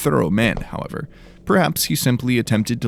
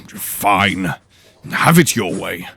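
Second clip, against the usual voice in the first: grumbling; 5 dB louder, much slower than usual. Acted. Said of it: "Henley grumbled".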